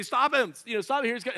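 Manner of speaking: high, loud comic alarm; mock panicked call. Follower hiding behind Jesus as demoniac runs up, mock panic. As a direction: call out for help in pretend panic.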